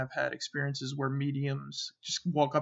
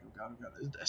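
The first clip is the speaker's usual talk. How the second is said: soft, barely-voiced "oh god, oh god"; hushed panic; 3 semitones lower, 12 dB quieter than usual. Freaking out internally in the dark room: hushed panic. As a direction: breathe it out in quiet fear.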